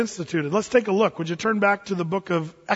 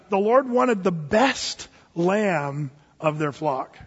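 slow, deliberate emphasis; much slower than usual. Real, not acted. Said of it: "preacher slows for emphasis".

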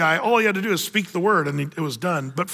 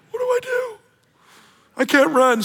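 high, shouted panic, trapped and desperate; panicked cry. Pretend. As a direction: cry out like someone cornered with nowhere to run. Woman caught, panicking.

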